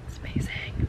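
breathy whisper of wonder at the view; whispered awe. Real, not acted. Vlogger to viewers, whispering in awe at snowy mountains.

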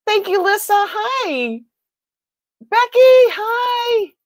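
excited greeting. Real, not acted. Streamer welcoming viewers by name, high and excited. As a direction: sing out each viewer's name.

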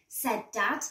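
ordinary delivery, nothing marked

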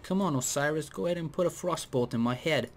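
high, odd little spider voice; a spider begging to be hit by a frostbolt, in a high spider voice; spider accent. Acted. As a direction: chirp it like an eager talking spider. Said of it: "that's the accent that spiders have".

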